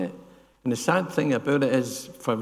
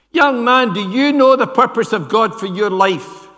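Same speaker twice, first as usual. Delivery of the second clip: high, booming pulpit challenge; a preacher from the pulpit challenging a young man, shouting; preacher's shout; 8 semitones higher, 9 dB louder than usual. Retold or imitated. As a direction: call it out across the church as the preacher. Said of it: "he just shouted".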